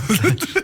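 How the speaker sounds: short rising laugh; a quick self-mocking laugh. Real, not acted. Host laughing at his poor Dutch accent, short laugh.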